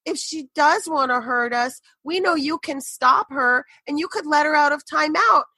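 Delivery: mock little-girl prayer ending very high; imitating praying little girl. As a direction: plead to God like a small child, building. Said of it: "this is what I hear".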